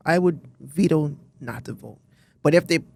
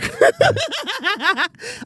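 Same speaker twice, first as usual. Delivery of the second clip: high-pitched burst of laughter; real high laugh. Real, not acted. Laughing at the word rare.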